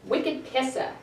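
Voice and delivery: "Wicked pissa" with no r, pissah; Boston accent. Shown as a demonstration. Teacher modelling Boston slang for the class.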